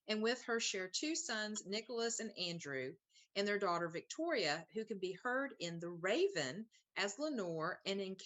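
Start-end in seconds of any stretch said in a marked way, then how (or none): none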